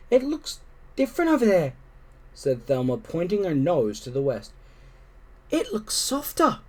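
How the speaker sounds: high, tired little kitten voice; a tired kitten asking to rest; kitten Thelma. Acted. Thelma to her siblings, high and tired.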